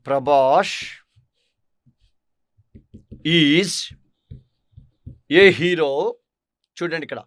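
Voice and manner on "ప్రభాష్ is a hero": loud, word by word with long pauses; word-by-word dictation. Shown as a demonstration. Teacher writing out an example for students, word-by-word dictation. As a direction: dictate it loudly, spacing out each word.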